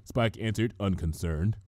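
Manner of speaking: ordinary delivery, nothing marked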